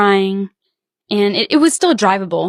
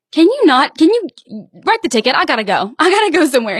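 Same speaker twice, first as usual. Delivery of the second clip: imitating her fed-up teenage self, pitched up; annoyed retort; 6 semitones higher than usual. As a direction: brush off the cop with a hint of whine. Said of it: "I was like".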